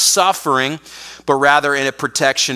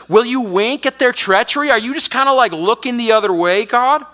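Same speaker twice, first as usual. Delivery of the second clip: higher, accusing question; accusing prophet; 6 semitones higher than usual. Retold or imitated. Habakkuk accusing God of looking away, high voice. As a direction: call God out. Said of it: "He, he says".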